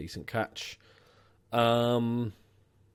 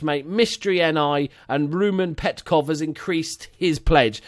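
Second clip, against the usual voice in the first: loud, high announcer name-read; announcer roll call; 5 semitones higher, 6 dB louder than usual. Pretend. Host announcing new Patreon supporters, loud announcer roll call. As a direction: proclaim each name like an announcer.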